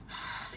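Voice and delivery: soft, voiceless burst of breath; empty whispered scream; failed scream demo. Shown as a demonstration. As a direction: attempt a high yell, only air comes out. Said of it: "Gitu, kayak gitu".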